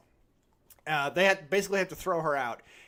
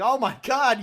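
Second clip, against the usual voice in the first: startled king; 6 semitones higher, 6 dB louder than usual. Retold or imitated. King shocked to see hag again.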